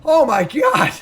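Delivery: loud, high, laughing shout of joy; loud gleeful shout; excited shout. Real, not acted. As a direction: yell in disbelief at the luck. Double hit on the wheel.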